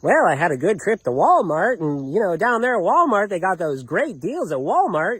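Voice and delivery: mock a cousin's sing-song chatter about shopping; cousin changing subject. Cousin trying to change the subject of a quarrel, imitated small talk. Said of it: "starts trying to change the subject".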